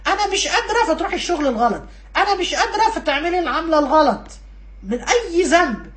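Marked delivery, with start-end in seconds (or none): 0.1-0.9 high-pitched "I can't"; acted helpless protest. Acted protest before giving in. 2.2-2.9 high-pitched "I can't"; acted helpless protest. Acted protest before giving in.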